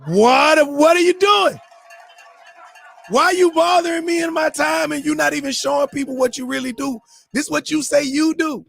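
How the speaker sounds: high, loud, incredulous yelling at a pretender; exasperated scolding. Scolding an aspiring radio host who shows nothing online, shouting high and loud. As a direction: blow up at someone wasting your time.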